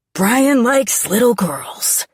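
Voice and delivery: loud, slow blurted accusation; shouting. Acted. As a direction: spit out the secret loudly. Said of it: "Dad shouts".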